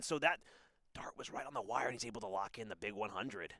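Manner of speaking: ordinary talk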